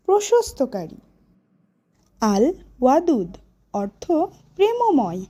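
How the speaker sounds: ordinary talk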